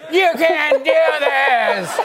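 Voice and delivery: put-on slow, high, loud old manager voice; hammed-up ancient manager croaking a protest. Ninety-five-year-old manager objecting to a sketch, hammed-up high croak. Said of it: "manager who was like".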